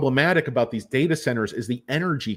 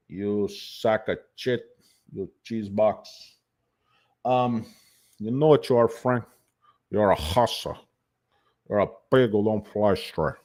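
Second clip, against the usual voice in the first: bad Cuban accent; 2 semitones lower than usual. Tony insulting Frank in a bad Cuban accent. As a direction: chew through it like marbles in a bad Cuban accent. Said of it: "your accent is terrible".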